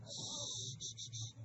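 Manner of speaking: long hissed shh to quiet the room; sharp teacher shushing. Real, not acted. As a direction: hush the class with repeated shushes. Teacher hushing noisy class.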